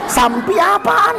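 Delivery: high, drawn-out challenge; mock objection. Teacher challenging the student's word, high mock objection. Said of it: "kata saya".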